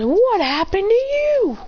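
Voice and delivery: high, drawn-out astonishment; shocked question. Acted. Seeing Lusamine all ragged up, shocked high question. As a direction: stretch the question out in high disbelief.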